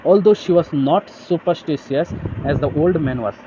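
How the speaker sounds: slow, careful reading of the text; slow reading aloud. Shown as a demonstration. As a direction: read it out word by word for the class. Reads English text slowly. (धीमे से पढ़ना)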